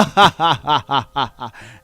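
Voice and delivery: loud, hammy villain cackle; evil laugh. Shown as a demonstration. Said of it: "That's my evil laugh".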